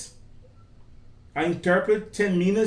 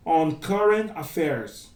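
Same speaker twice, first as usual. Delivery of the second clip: ordinary talk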